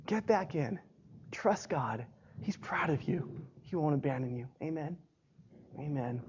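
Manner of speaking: very soft, low, near-whispered reassurance; hushed encouragement. Real, not acted. Preacher urging the fallen to trust God, hushed. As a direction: drop to a hush and reassure them.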